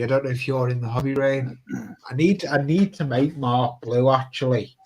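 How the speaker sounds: ordinary talk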